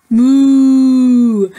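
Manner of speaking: loud, drawn-out cow moo. Acted. Teacher reading to kids, loud cow moo.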